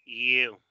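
you as a glided "yoo"; English letter U. Shown as a demonstration. Teacher repeating the correct name, you as "yoo". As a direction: say you with y before a long oo. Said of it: "se dice you".

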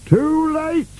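very high-pitched falling-away cry; shouting. A narrator's reading. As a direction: cry out as you plunge downward. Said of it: "shouted Burke".

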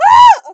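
sudden high scream; very loud, high shriek. Real, not acted. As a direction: scream out at a bad move. Screams at the game.

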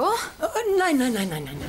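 emphatic no. Acted. Correcting wrong technique.

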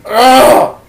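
frustrated loud groan. Real, not acted. Frustrated outburst at Ben.

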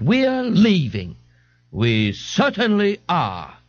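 slow haughty exit line; indignant, slow. Acted. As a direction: draw it out with huffy dignity.

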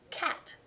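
"cat" with a back velar k at the start. Shown as a demonstration. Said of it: "This is shown clearly in the word".

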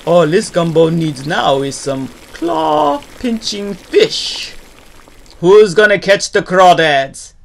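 loud, building holler; calling out. Acted. Gator asks critters to catch crawdads, hollering.

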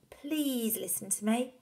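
put-on slow, high boy's plea; pleading son. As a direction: beg Dad, drawing it out.

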